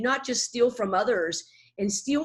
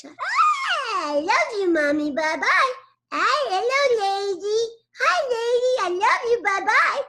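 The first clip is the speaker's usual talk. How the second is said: put-on very high, slow toddler chirp; Mindy voice; 11 semitones higher, 5 dB louder than usual. Shown as a demonstration. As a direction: squeal like a sweet toddler. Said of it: "She's a sweet little nugget".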